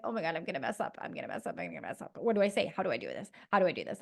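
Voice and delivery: hushed, low self-talk of a nervous stranger-meeting; anxious inner panic. Retold or imitated. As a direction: spiral into quiet panic under your breath. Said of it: "I'll be like".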